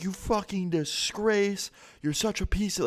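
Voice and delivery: high, slow, contemptuous scolding; imitating cussing dad. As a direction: sneer at the son like the raging dad. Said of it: "starts cussing his own son out".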